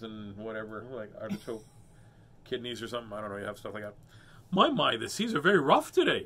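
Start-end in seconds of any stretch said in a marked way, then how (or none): 4.5-6.2 louder, higher lady's voice; raised, theatrical storybook lady; lady character. Acted. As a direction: play a fussy lady remarking on the seas. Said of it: "said the lady".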